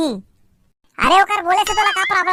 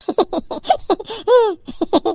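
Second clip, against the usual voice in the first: giggling through a fake cry; failed fake sob turning into laughter; laughing instead of crying. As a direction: break into giggles while trying to weep. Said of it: "दांत मत दिखा".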